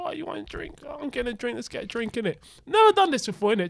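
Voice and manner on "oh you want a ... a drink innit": much softer, easy casual talk; quietly acting out small talk; soft retold chat.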